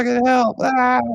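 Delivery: high panicked caged-victim shrieks; caged victim screaming. Retold or imitated. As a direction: scream like you're trapped in a cage.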